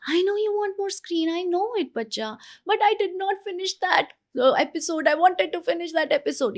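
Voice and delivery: put-on high, sing-song empathy for a child; empathizing parent. Shown as a demonstration. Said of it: "and you say".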